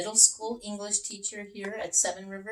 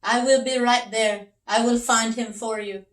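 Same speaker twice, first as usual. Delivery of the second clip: louder, firm heroic promise; confident detective; 6 semitones higher, 9 dB louder than usual. Acted.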